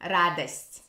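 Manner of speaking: радость with a reduced A-like first O, Moscow style; Moscow Russian. Shown as a demonstration. Teacher showing how Moscow Russian says радость. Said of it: "in Moscow Russian, it will be".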